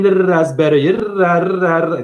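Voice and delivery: a loud, mocking sing-song da, da, da; mocking babble. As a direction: parody someone pointing and babbling. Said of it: "you saying".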